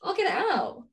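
ordinary talk

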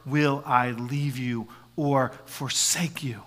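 slow, unvoiced, intimate whisper; whispered promise. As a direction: whisper Jesus's promise close. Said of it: "the same voice that Jesus speaks".

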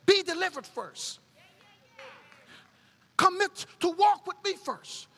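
high, loud preached call; voicing God from the pulpit, full force; preaching God's words. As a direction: lift it up as God's invitation.

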